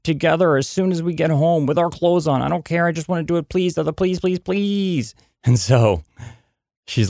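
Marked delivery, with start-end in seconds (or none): 0.0-5.1 put-on much higher, pleading little girl; begging child. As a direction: beg like a five-year-old desperate for the pool. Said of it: "she's like".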